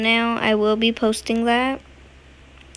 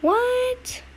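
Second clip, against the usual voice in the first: high shocked what; startled shriek of a question; surprised; 13 semitones higher than usual. Real, not acted. Sudden surprise in game.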